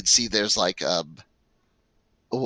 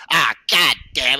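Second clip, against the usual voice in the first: put-on southern accent; 5 semitones higher, 6 dB louder than usual. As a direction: curse like a Southern mechanic. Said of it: "I can't speak in a southern accent, but that's definitely what he sounds like".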